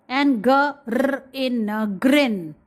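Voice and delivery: g, r, e, n sounded slowly, then grin; slow phonics blending grin. Shown as a demonstration. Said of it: "In this way".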